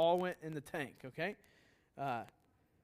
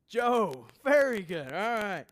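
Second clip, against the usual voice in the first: louder, higher-pitched congratulation to the room; loud approval; 3 semitones higher, 9 dB louder, slower than usual. Real, not acted. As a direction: burst out with loud praise. Praising the congregation's correct answer.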